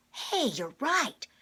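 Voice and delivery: put-on dawning, eager girl; excited girl. A narrator's reading. Kate character voice.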